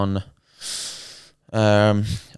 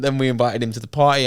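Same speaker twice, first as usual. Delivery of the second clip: normal talking voice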